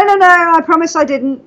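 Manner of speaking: ordinary talk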